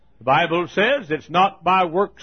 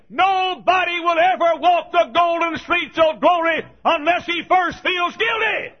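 full-force preaching; 8 semitones higher than usual. Real, not acted. Preacher shouting guilt at the congregation, full force. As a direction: hammer each guilty home.

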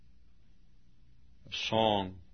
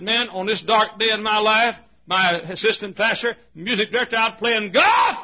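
outraged shout; 10 semitones higher, 9 dB louder than usual. Retold or imitated. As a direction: rage at the assistant pastor golfing. Outraged preacher retold.

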